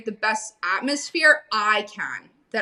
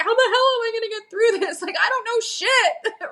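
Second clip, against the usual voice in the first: pitched-up mock despair of someone crying daily; panicked self-quote; 12 semitones higher than usual. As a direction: play your beginner self drowning in panic. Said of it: "crying every single day in the beginning".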